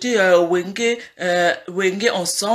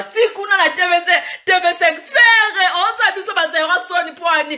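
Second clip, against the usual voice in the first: laughing mockery; 7 semitones higher than usual. Mocking TV5 shame, laughing.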